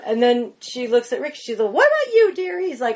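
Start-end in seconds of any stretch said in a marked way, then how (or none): 1.7-2.7 put-on high fluting old lady voice; high mock grande dame asking; Julia Child.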